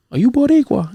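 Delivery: put-on high-pitched quoted old lady's question; imitating old lady. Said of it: "she's an old lady".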